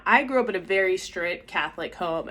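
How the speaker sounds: ordinary talk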